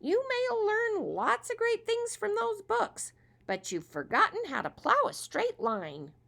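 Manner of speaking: very high, loud squeaky scolding; scolding squirrel. Acted. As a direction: tease him like a cheeky squirrel. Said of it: "the make-believe friend scolded him".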